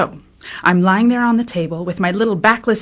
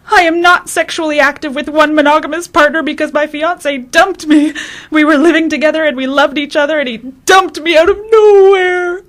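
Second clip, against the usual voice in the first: higher, breaking voice, near sobs; a wife left without warning, sobbing her story to the doctor; crying through heaves; 5 semitones higher than usual. Retold or imitated. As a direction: push the words out through heaving sobs. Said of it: "talk through the heaves".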